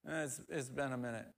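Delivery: soft, mumbled admission; sheepish son. Retold or imitated. Grown son confessing to his father, soft and sheepish. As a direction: own up quietly, a bit embarrassed.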